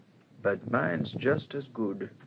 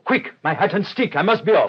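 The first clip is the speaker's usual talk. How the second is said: higher and louder, in a rush; hurried urgency; 8 semitones higher, 7 dB louder than usual. Acted. Detective calls for hat to chase the clergymen, urgent. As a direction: snap it out, already leaving.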